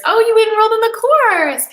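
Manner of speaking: high, loud burst of delight; excited squeal. Real, not acted. Host to a viewer who enrolled, excited squeal. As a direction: gush at the good news.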